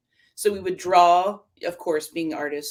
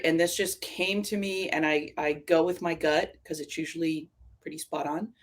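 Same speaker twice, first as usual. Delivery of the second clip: usual speaking voice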